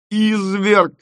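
high, drawn-out moaned accusation; moaning. A narrator's reading. Bride to the inventor who wronged them, moaning. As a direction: moan it out, pitched high. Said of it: "простонала Тося".

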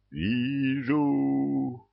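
high, hollow otherworldly cry; eerie graveyard voice; sepulchral voice. A narrator's reading. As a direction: call out like a ghost from the grave. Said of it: "за могильным голосом".

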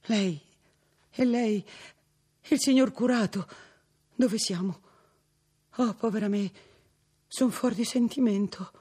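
high, trembling bewildered cry; girl recognising the priest, distraught and bewildered; distraught exclamation. A narrator's reading. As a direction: cry out in confused relief. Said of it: "esclamò".

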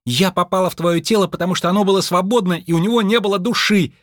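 high-pitched, irritated self-defence; heated hissing retort. Acted. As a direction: fire back high and heated. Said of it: "зашипела на него двадцать два". (раздражённо огрызаясь)

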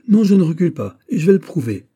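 loud, high indignant protest; exclaimed. Acted. Philippe insists to his mother he will not retreat, exclaimed. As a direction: cry out defiantly, pitched well up. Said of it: "s'écria Philippe".

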